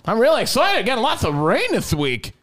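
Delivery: high excited mock voice; imitating Iowa Sam. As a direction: play the odd fella, giddy. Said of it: "That's what he said".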